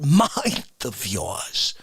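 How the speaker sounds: ordinary talk, nothing marked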